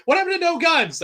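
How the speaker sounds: high, loud shocked Gordon. Retold or imitated. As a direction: squeal it out in disbelief. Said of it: "Gordon's like".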